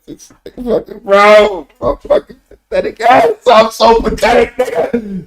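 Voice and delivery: very high, laughing squeal; squealing self-mockery. Real, not acted. Streamer mocks himself to chat, high laughing squeal. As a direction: cracks up at his own pathetic state.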